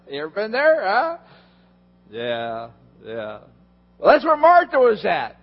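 ordinary talk, nothing marked

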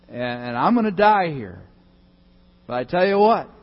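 loud, defiant taunt from the cross; superhero Jesus taunting. Pretend. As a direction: call it down like a superhero who knows he wins. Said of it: "I can imagine Jesus Christ, the superhero God, up there saying".

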